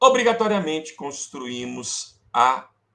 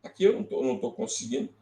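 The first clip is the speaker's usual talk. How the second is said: ordinary delivery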